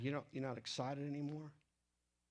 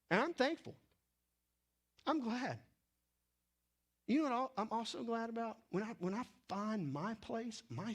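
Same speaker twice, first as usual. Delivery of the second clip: fervent preaching; 7 semitones higher than usual. Real, not acted. Preacher rising in fervor.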